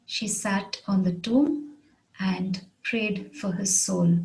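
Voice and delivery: measured dictation with gaps between phrases. Shown as a demonstration. Example repeated slowly for learners.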